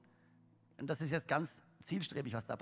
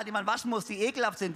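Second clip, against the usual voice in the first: usual speaking voice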